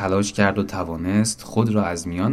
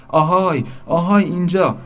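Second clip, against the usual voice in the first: high, loud hailing call; shouting; 7 semitones higher, 4 dB louder than usual. A narrator's reading. Boat calling sharks to come eat it, shouting. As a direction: yell eagerly to summon them from afar. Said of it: "رو به کوسه ها فریاد زد".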